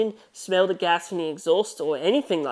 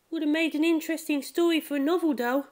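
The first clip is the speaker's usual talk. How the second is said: put-on much higher, light girlish voice; girl's voice; 11 semitones higher than usual. Futaba, musing to Takuma about the book, higher girl voice. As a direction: lift into a young girl musing.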